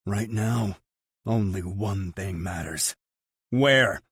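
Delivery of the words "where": loud, sharp demand. A narrator's reading. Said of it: "I demand".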